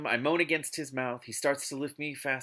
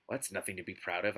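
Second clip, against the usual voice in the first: mildly haughty, understated; lightly superior remark; haughty mutter; 5 semitones lower, 5 dB quieter, faster than usual. A narrator's reading. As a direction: mutter it with a touch of disdain. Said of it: "I mutter haughtily".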